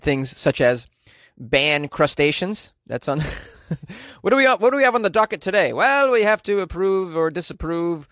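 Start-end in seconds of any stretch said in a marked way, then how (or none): 4.2-8.1 higher, put-on bureaucrat voice; mock EU official. European Union official opening the day's docket, mock bureaucrat voice. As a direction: rattle through the agenda as a comic official.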